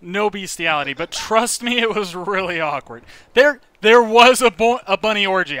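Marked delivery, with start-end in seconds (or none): 3.4-5.6 excited blurt. Real, not acted. Building up the bunny story.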